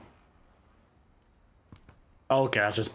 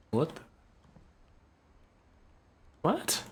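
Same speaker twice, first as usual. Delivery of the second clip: high squeaky what; shocked; 5 semitones higher, 4 dB quieter than usual. Real, not acted. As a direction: squeak it in disbelief. Shocked at game event.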